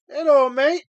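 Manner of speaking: loud, high mock Australian greeting; a quick hammed-up Australian hello. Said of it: "that's Australian".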